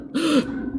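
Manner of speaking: sharp soft gasp of realization; a quick startled intake of breath. Retold or imitated. Realizing the mic is in her pants, a soft gasp.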